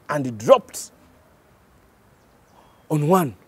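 ordinary delivery

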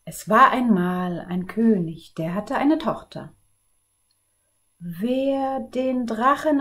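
put-on lively read-aloud for children; storybook narration. A narrator's reading. Librarian reading a fairy tale to children, lively and clear. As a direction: tell the tale to the kids with energy.

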